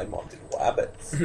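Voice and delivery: soft unvoiced hunter whisper; Elmer Fudd whisper. Friend joining the hunter parody in a quiet whisper. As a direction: breathe it like a sneaking cartoon hunter.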